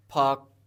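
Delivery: 'फाक' loud, with the final k held back. Shown as a demonstration. Teacher showing how to hold the final k in 'फाक'. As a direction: stop the k without releasing it. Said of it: "रोक लेंगे".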